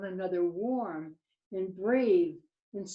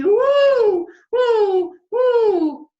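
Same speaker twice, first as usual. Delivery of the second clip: very high, loud hoots; three piercingly high joyful hoots; excited owl hoots; 13 semitones higher, 13 dB louder, much slower than usual. Acted. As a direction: whoop like thrilled baby owls. Owlets hooting happily.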